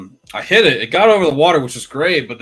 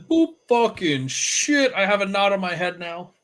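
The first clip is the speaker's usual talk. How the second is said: tree's voice; 8 semitones higher, 5 dB louder than usual. Pretend. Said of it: "It's like".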